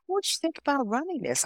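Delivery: high, quick imitated suggestion; acted enticing offer. Recruiter tempting the visiting student to run the station, high acted coaxing. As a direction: float the offer fast and high.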